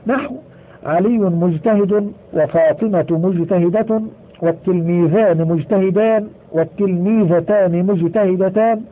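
usual speaking voice

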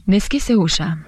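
ordinary delivery, nothing marked

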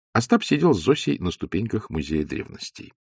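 ordinary delivery

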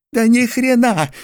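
high barked retort; Mordecai barks. A narrator's reading. Mordecai barks back at George, high loud retort. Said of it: "рявкнул Мордехай".

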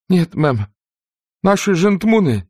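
breathless high shout; out of breath. Acted. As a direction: gasp it out after running upstairs. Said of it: "задыхаясь, выкрикнула Присси". (запыхавшись, выкрикивая)

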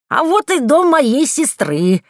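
high, loud, drawn-out witch cry; exclaiming. A narrator's reading. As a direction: announce it slowly and loudly as Yaga. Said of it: "воскликнула Яга". (громкий протяжный возглас Яги)

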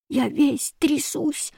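small, high, slow, soft complaint; shivering child's slow whine; plaintive boy. A narrator's reading. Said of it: "жаловался негритёнок".

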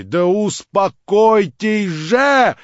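громко, высоко и очень медленно; крикни в исступлении; неистовый крик. Acted. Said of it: "крикнула она неистово". (неистовый крик)

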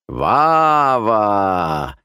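loud, stretched-out greeting; delighted exclamation. A narrator's reading. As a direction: cry out to a dear boy. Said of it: "воскликнул маменькин лучший друг". (радостно воскликнуть нараспев)

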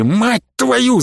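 высокий крик, почти без прибавки громкости; выругайся высоким криком; истошный крик. A narrator's reading. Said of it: "заорал ещё один наёмник". (высоким надрывным криком)

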